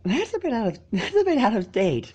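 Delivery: high, laughing through the line. Acted. Filly answering Spike, laughing lightly. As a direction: giggle it off. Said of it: "she laughed".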